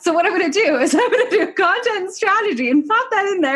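amused, conspiratorial and high; laughing mock pitch. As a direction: let laughter bubble through the pretend sales pitch. Amused retelling of sneaky pitch.

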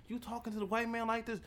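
high, quick scandalised question; mock shocked coworker. Coworkers scandalised at him, fast high mock question. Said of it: "they be looking at me like".

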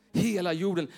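normal talking voice

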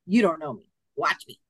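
low, cool retold comeback; defiant challenge. Client answering the doubting healer, coolly defiant.

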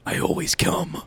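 low, breathy seductive whisper; sultry whisper. Acted. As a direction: purr it low into her ear. Agent J's smooth whisper.

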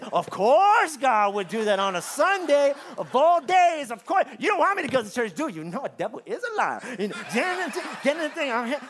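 high, loud sarcastic griping at God; mock exasperated complaint. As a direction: play the put-upon churchgoer, overdone. Frustrated churchgoer complaining to God.

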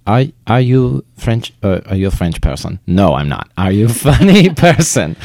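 put-on a deliberately terrible Lumiere-style French accent. Retelling the board member's question in a bad French accent. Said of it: "terrible French accent".

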